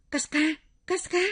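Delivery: high, urgent call of the name; crying out. A narrator's reading. Narrator calls out to wake the screaming friend, crying out. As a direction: call out loud and high in alarm. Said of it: "Tôi bèn hô hoán".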